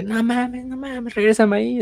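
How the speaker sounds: whiny hammed-up Dante; anguished plea. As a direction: beg to be sent back, overacted. Dante revived, begging to return.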